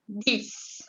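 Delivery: "Dix" ending in an S sound. Shown as a demonstration. Teacher showing French ten, X as S. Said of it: "La X se pronuncia como una S".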